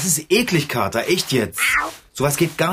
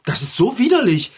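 disgusted outburst; 7 semitones higher than usual. Acted. Figarino ekelt sich über die Pfütze, entrüstet. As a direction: let the revulsion burst out.

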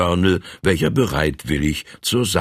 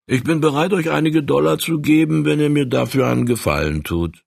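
a higher, friendly voice for the stranger; voiced stranger; 10 semitones higher, faster than usual. A narrator's reading. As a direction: coax the stoker a little higher. Stranger offers money. (der Fremde lockt den Heizer mit höherer Stimme)

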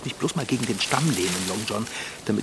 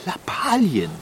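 Lappalien thrown back higher and louder in disbelief; surprised echo; 6 semitones higher, 4 dB louder, slower than usual. Acted. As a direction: question the word Lappalien as if it were absurd. Repeating the quoted word back.

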